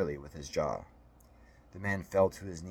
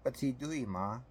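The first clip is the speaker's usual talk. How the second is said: put-on little-kid voice, pitched high; child's question; 6 semitones higher than usual. A narrator's reading. Alex asks his mother too, in a high little-boy voice. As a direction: echo the question as a higher-voiced small child. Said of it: "Alex asked".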